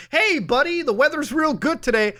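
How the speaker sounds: high, friendly neighbor impression; cheery neighbor voice. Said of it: "the worst thing I ever get from him is".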